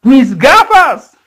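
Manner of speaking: woman shouting over her broken glasses. Retold or imitated. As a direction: cry out "¡Mis gafas!" as the glasses break. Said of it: "Ella grita".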